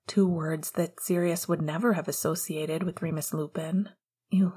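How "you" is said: shaky, halting stutter. Acted. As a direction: stammer it, stunned. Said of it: "he stuttered".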